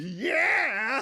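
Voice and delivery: high, loud mock yell of the healer; mock shout. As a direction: belt it out like her battle cry.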